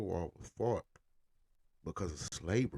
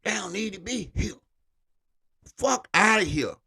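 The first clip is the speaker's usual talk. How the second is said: higher, louder mock Lincoln; 9 semitones higher, 6 dB louder than usual. Imitating Lincoln about freed slaves, high and scornful. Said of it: "he was like".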